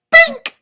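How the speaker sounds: very high, loud bink; ping it out high like a tiny bell; squeaky cartoon bink; high bink sound. Sound effect word in story.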